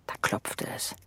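hushed, voiceless and soft; a storyteller's hush before the knock; suspenseful whisper. A narrator's reading.

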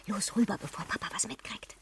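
conspiratorial whisper. Acted. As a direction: whisper so dad won't hear. Heimlich vor Papa. (verschwörerisches Flüstern)

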